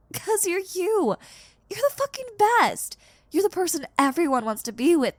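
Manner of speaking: high, loud, fervent insistence; passionate praise. Acted. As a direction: make them believe they're the best.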